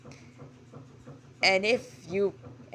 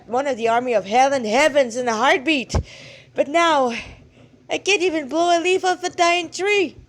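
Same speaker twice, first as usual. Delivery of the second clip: louder, higher blustering god; embarrassed old god; 4 semitones higher, 6 dB louder than usual. Acted. Blushing god admitting his lost power to the girl, louder. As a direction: bluster through an awkward plea.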